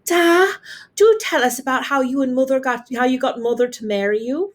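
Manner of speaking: ordinary delivery, nothing marked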